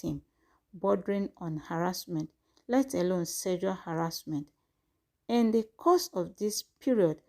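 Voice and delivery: measured, formal news read; formal statement reading. Acted. Reads out formal press statement.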